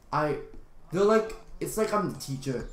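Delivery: usual speaking voice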